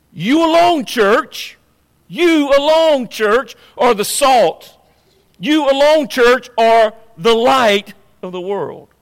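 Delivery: ringing, insistent stress on "You alone"; emphatic YOU ALONE. Real, not acted. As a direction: hammer "You alone" like a preacher's refrain. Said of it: "an emphatic you alone".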